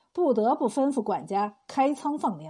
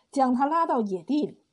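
ordinary delivery

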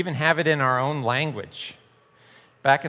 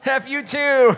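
mock comeback; 12 semitones higher, 6 dB louder, slower than usual.

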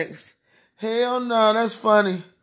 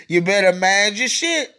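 ordinary talk, nothing marked

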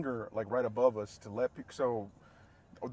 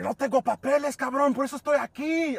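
imitating an indignant coworker, high and loud; heated retort; 12 semitones higher, 8 dB louder than usual. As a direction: throw it back as a raised-voice protest. Acted quarrel with angry coworker.

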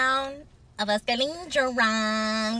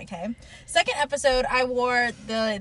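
ordinary talk

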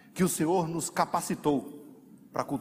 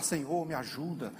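reluctant prayer; 8 dB quieter than usual. Retold or imitated. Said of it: "Aí você vai orar".

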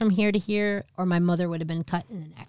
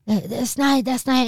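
higher, stammered retraction; flustered denial; 7 semitones higher, 3 dB louder than usual. Retold or imitated. Mom backtracks to her child, flustered stammer. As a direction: stumble over a repeated denial.

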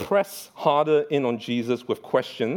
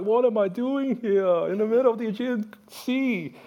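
mock crying; 11 semitones higher than usual. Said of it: "like, crying".

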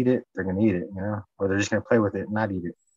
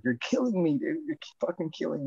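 high-pitched whine, fading off; mock pleading protest; 7 semitones higher, 5 dB quieter than usual. Owner to the cat on the chest, mock pleading protest. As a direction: complain in a raised, hammed-up plea.

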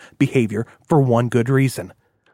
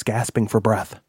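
usual speaking voice

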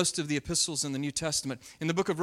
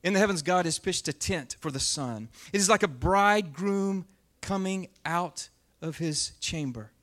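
dramatic scripture reading; 4 dB louder than usual. Acted. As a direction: declaim the psalm to the room. Reads psalm aloud with force.

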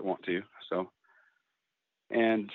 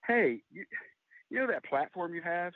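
a mother's voice pitched higher, lightly teasing; 8 semitones higher than usual. Retold or imitated. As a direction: voice Mom poking at her son, voiced a little higher. Mother calling, retold phone call.

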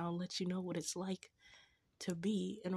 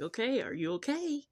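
playful, louder rising question; concerned check-in; 6 semitones higher, 5 dB louder than usual. Retold or imitated. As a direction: check on them with mock concern. Acting out checking on him.

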